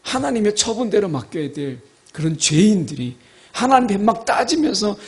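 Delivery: ordinary delivery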